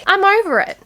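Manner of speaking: jumps high and loud on OVER, fed up. Real, not acted. To viewers about overthinking content, emphatic fed-up outburst. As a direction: throw it off like a weight, I'm over it. Declaring she is done overthinking.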